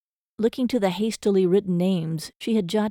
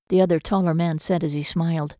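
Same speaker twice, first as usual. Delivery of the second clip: ordinary delivery, nothing marked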